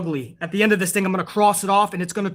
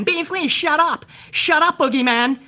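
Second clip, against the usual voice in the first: high, whiny voice mocking an annoying fan; a snotty viewer scolding the host in a high voice; 4 semitones higher than usual. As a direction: sneer it like a petulant chat troll. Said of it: "all the little bitch boys that come in".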